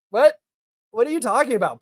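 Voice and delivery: sharp high "What?" of disbelief; incredulous. Real, not acted. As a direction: react like the claim is absurd. Scoffing at a chat claim.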